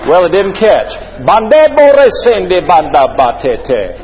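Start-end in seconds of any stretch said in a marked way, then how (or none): 1.3-4.0 sputtering engine sound. Pretend. Engine fails to catch, gibberish.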